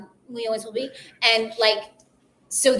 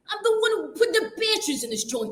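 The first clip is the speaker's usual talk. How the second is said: Rodney Dangerfield bit; 7 semitones higher, 5 dB louder than usual. Retold or imitated. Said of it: "Easy Money with, uh, Rodney Dangerfield".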